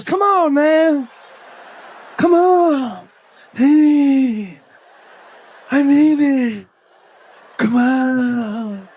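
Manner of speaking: loud, drawn-out pleas trailing into mumbles; Joe Biden. Acted. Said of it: "He'll be up there and be like".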